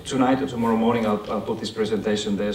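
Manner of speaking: normal talking voice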